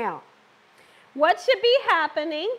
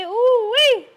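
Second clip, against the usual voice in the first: ooh-wee exclamation; 7 semitones higher, 7 dB louder than usual. Real, not acted. Exclaiming at oily stove.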